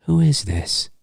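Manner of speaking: soft, faintly menacing repeat; a touch of sinister in an almost normal voice; normal but sinister. Retold or imitated. Host repeating Ghostface's question with a hint of menace. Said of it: "a hint of sinister".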